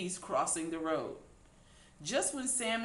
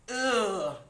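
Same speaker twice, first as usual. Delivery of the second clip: louder cry of disgust; 4 semitones higher, 7 dB louder than usual. A narrator's reading. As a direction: recoil in disgust. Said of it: "cried Cindy".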